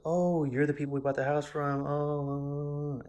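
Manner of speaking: high, loud drawn-out "oh" of recognition; acted-out realization. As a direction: play up the sudden recognition. Acts out recognizing previous owners.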